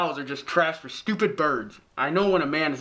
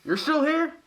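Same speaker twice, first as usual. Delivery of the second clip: surprised; 12 semitones higher than usual. Acted. Father finds son still home.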